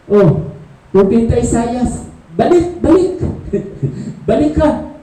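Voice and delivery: high, laughing call to the prophet; high playful divine call; God calling. Acted. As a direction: call him back, laughing. God calls back prophet Isaiah.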